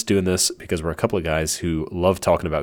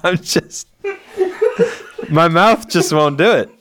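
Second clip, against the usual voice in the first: high, loud giggling through the words; can't get the words out for laughing; laughing fit; 10 semitones higher, 3 dB louder than usual. Real, not acted. Host cracking up after flubbing the intro, laughing fit. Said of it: "I can't stop laughing".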